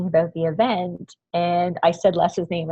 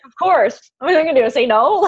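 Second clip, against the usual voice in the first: giddy eager reply; 6 semitones higher, 8 dB louder, faster than usual. Retold or imitated. Retelling giving up her seat.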